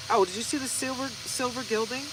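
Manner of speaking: normal talking voice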